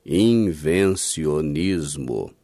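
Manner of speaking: Invencionismo said very slowly, each syllable stretched, low and falling; drawn-out key word. Shown as a demonstration. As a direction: spell out Invencionismo slowly for emphasis. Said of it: "eu vou te dar o nome disso".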